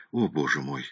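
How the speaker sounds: low, soft moan; dismayed groan. A narrator's reading. Poor client reacts to the price, groaning low. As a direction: sink into a quiet groan of despair. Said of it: "застонал бедняга Фредди".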